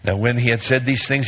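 usual speaking voice

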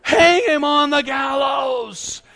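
loud, high, slow proclamation; commanding shout. Acted. Entourage at the house ordering Haman hanged, bellowing. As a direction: bellow the sentence, stretching it out.

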